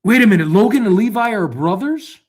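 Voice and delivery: a double take as it clicks; startled wait-a-minute; surprised realization. Real, not acted.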